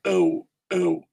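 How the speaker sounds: "ou" as an isolated gliding vowel; ou sound. Shown as a demonstration. Teacher isolating the O sound, "ou" as a diphthong. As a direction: sound out "ou" as in English oh. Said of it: "Ele tem esse som de".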